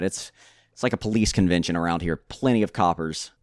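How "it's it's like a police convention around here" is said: ordinary delivery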